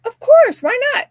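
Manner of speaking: excited retold reply; eager of course. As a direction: burst out with happy generosity. Eagerly offering to teach.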